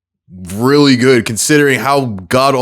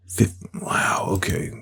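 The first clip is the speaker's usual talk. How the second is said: hushed, unvoiced wow; whispered surprise; 2 semitones lower, 11 dB quieter, slower than usual. Real, not acted. Seeing a rare pick, whispering in surprise. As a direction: breathe the words in quiet disbelief.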